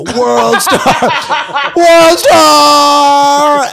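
loud, high hype yell; screaming World Star. Retold or imitated. Comic in a bar yelling at his own fight. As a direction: shout it out like a hype man at a fight. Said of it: "I just go".

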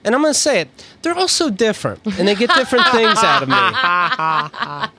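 diplomatic actor dodging the question; mock celebrity answer. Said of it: "Ask me who my favorite director is".

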